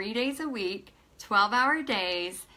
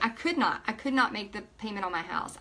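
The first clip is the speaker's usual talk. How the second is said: normal talking voice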